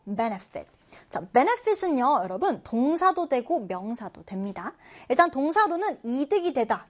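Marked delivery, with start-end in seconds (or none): 0.1-0.7 benefit with the stress on BEN; stress on first syllable. Shown as a demonstration. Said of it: "첫 음절의 강세를 두고".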